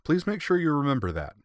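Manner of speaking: put-on high, bright young girl with a grin; cheeky young girl, pitched high; grinning girl. A narrator's reading. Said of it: "she added with a grin".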